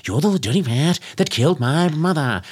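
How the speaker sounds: a hint of a hack gangster voice; mild old-movie tough guy; half-hearted Cagney bit; lightly voiced Cagney. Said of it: "someone came out and went".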